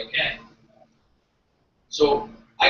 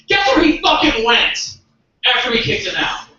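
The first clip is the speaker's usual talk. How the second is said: indignant shout; 8 semitones higher, 6 dB louder than usual. Real, not acted. Comic telling audience where the evicted man went, indignant shout. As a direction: shout it in high-pitched exasperation.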